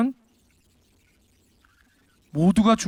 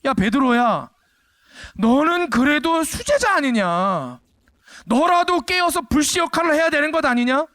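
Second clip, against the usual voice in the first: a raised, pleading rebuke, higher and slow at first; Jesus scolding Peter; 8 semitones higher, slower than usual. Retold or imitated. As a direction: chide your top disciple for sleeping. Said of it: "무슨 말입니까?". (베드로를 꾸짖는 예수님, 높고 간절한 책망)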